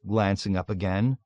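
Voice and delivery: plain reading voice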